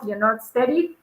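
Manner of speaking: normal talking voice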